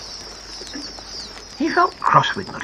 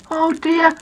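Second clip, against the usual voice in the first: high-pitched little character in dismay; alarmed; 11 semitones higher than usual. Acted. Noddy realises he is in trouble, alarmed. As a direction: exclaim in a high, worried voice. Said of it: "said Noddy in alarm".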